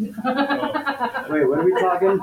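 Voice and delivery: high, loud, stunned; shocked disbelief. Real, not acted. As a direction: blurt it out in shock. Shocked reaction to odd question.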